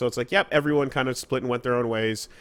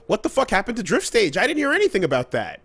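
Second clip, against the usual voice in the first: baffled; 9 semitones higher, 4 dB louder than usual. Retold or imitated. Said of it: "And I'm like".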